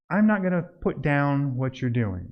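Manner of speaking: ordinary talk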